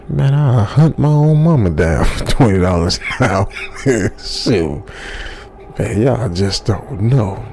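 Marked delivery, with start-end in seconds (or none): 0.1-4.8 laughing. Real, not acted. Joking about hunting mama.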